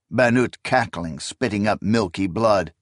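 plain reading voice

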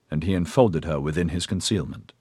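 usual speaking voice

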